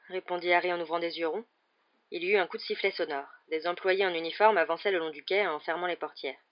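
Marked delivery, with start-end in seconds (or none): none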